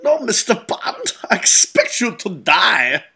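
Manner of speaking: lightly voiced Bond villain; villain line quoted. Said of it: "that line".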